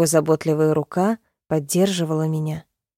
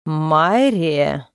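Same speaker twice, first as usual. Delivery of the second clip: booming thunder; 2 semitones lower than usual. A narrator's reading. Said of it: "прогремел голос дракона".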